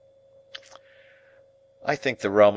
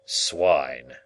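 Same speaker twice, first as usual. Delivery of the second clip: a lower, booming churchman; deepest voice; 6 semitones lower than usual. Acted. Churchman intoning at the boy, in his deepest voice. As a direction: drop the voice low and grave. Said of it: "in his deepest voice".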